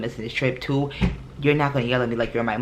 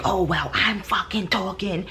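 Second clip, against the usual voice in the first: higher, slow, biting retort; mock angry snap; 6 semitones higher than usual. Retelling her friend yelling at her on the phone, mock angry snap. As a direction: throw her friend's snapping words back at her. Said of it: "when she started screaming".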